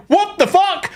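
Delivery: high, hammed-up disbelief; mock bewildered outcry. Crash victims reacting to Lilith, mock outrage. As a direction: cry out in baffled shock. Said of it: "leaving everyone going".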